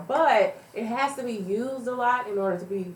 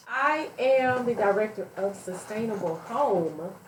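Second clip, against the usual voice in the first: ordinary talk, nothing marked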